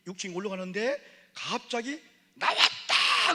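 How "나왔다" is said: drawn-out breathy loud cry of joy; mock triumphant yell; excited shout. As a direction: announce it like a triumph. Pastor shouting news.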